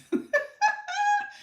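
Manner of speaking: high squealing burst of laughter; delighted laugh. Real, not acted. Host laughing at viewer's fun food question, high squeal. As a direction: let a shrieking laugh of delight escape.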